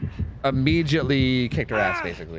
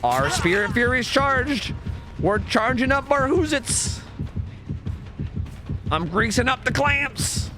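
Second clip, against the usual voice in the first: higher, slow, grand hype-man voice; mock announcer boast; 10 semitones higher, slower than usual. To the stream, hyping up the killer build in a slow, high announcer voice. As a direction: announce each upgrade like a trailer.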